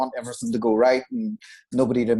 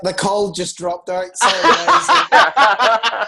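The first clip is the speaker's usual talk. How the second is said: loud, high, laughing fake apology; laughing mock excuse; 5 semitones higher, 10 dB louder, slower than usual. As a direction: pretend the line dropped while cracking up. Joke excuse of a dropped call.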